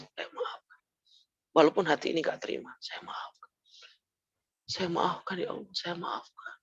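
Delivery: whispered through tears, fading; crying forgiveness. Retold or imitated. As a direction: sob out the forgiveness, barely voiced. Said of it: "Sambil nangis dia".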